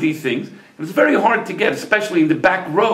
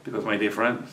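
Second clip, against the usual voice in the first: soft, low closing address to listeners; heartfelt moral; 7 semitones lower, 5 dB quieter than usual. Real, not acted. Storyteller tells dear friends the lesson, soft heartfelt moral. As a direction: close the tale gently and earnestly, with long pauses.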